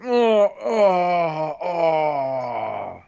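drawn-out mock moans; mock moaning. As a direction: ham up a toy moaning. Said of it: "the weaponizer's like".

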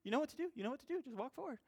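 mock pleading that fades out; coaxing shoes. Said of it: "it was like talking to the shoes".

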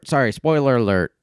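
normal talking voice

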